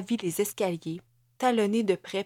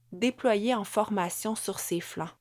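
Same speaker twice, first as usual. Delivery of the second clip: plain reading voice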